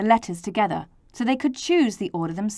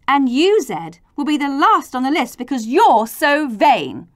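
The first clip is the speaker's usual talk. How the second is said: raised, higher telling-off; scolding; 5 semitones higher, 7 dB louder than usual. Acted. Grown-ups putting vain Z last, scolding. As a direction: tell off firmly and loudly.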